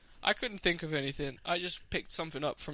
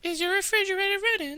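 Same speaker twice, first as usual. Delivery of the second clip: loud, very high falsetto; squeaky pop-star prank caller; 5 dB louder than usual. Acted. Prank caller asking about the fridge, high falsetto star impression. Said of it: "I'm doing [name]".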